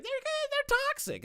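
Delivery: very high mock complainer voice; mocking whine. Streamer imagining Tipster blaming another community, mocking squeal.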